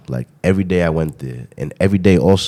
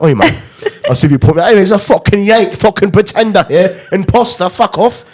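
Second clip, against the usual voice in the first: high, louder hammed-up British accent jeering a yank; mock London pub voice; 11 semitones higher, 4 dB louder than usual. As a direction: play a London bloke telling off an imposter. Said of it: "blend in with you lot".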